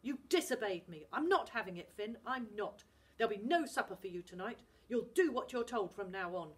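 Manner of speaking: loud, stern scolding; angry Scottish dad. Acted. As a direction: lay down the law to the boy. Dad punishes Finn.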